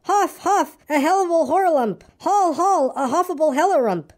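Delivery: high, frantic little voice; panicked crying out. A narrator's reading. Piglet scampers away from the trap, frantic high cry. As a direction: keep crying out high as the words jumble. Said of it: "still crying out".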